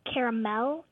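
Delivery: CARAMEL in three syllables, ending in a clear "mel"; dad's pronunciation. Retold or imitated. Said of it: "So he calls it".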